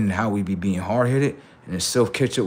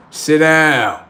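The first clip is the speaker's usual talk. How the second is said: loud, raised order; sharp, forceful command; stern command; 4 semitones higher, 8 dB louder than usual. Acted. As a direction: order a stubborn kid to obey. Strict self giving orders.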